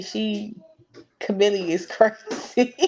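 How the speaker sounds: ordinary talk, nothing marked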